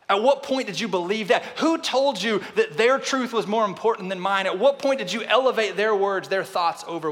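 high, loud preaching build; forceful preaching. Acted. Pastor pressing the church, preaching hard. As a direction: drive the questions home from the pulpit.

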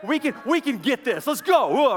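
rally the squad with mock Marine zeal; gung-ho Marine. Marine urging friends to push through the crowd, lightly voiced gung-ho. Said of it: "maybe he was a Marine or something".

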